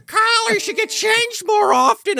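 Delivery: high-pitched impression of a reproachful woman; Angela Bassett. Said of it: "that was a perfect Angela Bassett impression".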